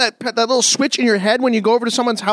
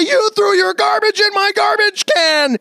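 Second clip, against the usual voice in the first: high, slow indignant yell down the phone; indignant shout; 8 semitones higher, slower than usual. Retold or imitated. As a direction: accuse him outright, offended. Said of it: "phone the person and say".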